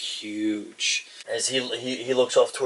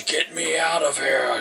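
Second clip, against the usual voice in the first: lightly voiced growly half-orc, pitched up; growling half-orc; 5 semitones higher than usual. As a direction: growl it lightly, demanding release. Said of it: "he gives a real heavy, like, growl".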